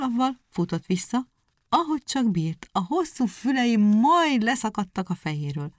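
plain reading voice

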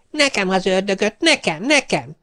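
put-on high, excited children's cry; greedy kids calling out for the devil; eager children clamouring. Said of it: "Mind azt kiabálták". (gyerekes, izgatott kiabálás)

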